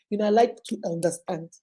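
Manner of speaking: usual speaking voice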